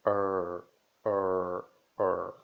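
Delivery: er, loud curled R; strong R sound. Shown as a demonstration. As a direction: growl out the er. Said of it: "esse som forte aí". (er com R forte)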